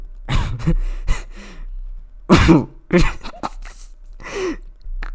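high breathy giggle. Real, not acted. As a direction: burst into a giggle. Laughing mid line.

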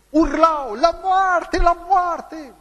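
high, loud mob cry for death; crowd shouting. Retold or imitated. Preacher voicing the courtroom mob screaming for death, loud and high. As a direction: yell it like an angry crowd.

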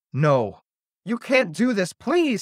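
high, climbing plea; desperate pleading. A narrator's reading. Jenny begs the Pumpkin Lord for Kate, desperately. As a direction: beg urgently, rising to a high please.

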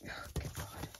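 muttered whispered exclamation. Real, not acted. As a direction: whisper it under your breath. Struggling with the card.

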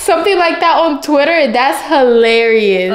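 real thrilled outburst; excited amazement. Real, not acted. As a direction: exclaim in delighted disbelief at a gift. Delighted reaction to surprise gift.

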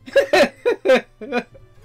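bursting into a loud, high laugh; loud delighted laugh. Real, not acted. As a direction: laugh out loud at something adorable. Delighted at cute animation.

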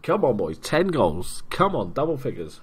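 loud rallying cheer; urging the team. Real, not acted. Pushing his team for a tenth goal, loud eager cheer.

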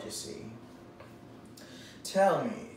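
normal talking voice

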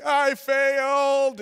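high, drawn-out mock wail; hammed-up despairing cry; wailing confession. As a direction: wail it slowly in defeat. Confession after being caught.

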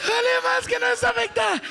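very high shout; shouted punchline. Acted. Comedian shouts the punchline high, mocking the deafened aunt. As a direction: yell it out over the noise.

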